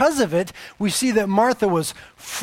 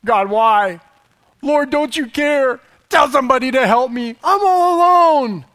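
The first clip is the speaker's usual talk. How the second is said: loud, high, whiny complaint; mad at God; 5 semitones higher, 6 dB louder than usual. Retold or imitated. Preacher mimics himself yelling at God, high and loud. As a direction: cry out, feeling abandoned. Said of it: "I get mad at God".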